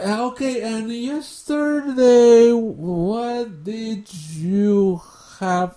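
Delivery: hammed-up stiff textbook English speaker; slow halting English. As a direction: mimic a learner building each sentence word by word. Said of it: "Hablando de esa manera".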